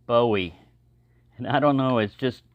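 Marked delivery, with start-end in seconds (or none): 0.1-0.6 "Bowie" said as BOH-ee; imitated mispronunciation. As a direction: say "Bowie" with a long O, like "bow" in rainbow. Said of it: "a guy kept saying".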